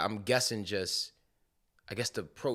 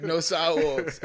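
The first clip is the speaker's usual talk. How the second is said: high, loud repeat of the complaint; mock outrage at no sidewalks; emphatic echo; 7 semitones higher, 7 dB louder, slower than usual. Real, not acted. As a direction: throw it back louder.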